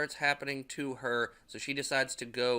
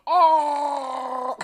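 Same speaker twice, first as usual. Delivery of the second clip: gagging cry; 13 semitones higher, 12 dB louder than usual. Retold or imitated. Arm shoved into her mouth.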